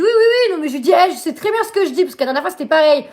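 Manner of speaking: high, loud retold outburst; loud, high replay of yelling at the operator; retold angry shout. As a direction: cut them off, fed up and insistent.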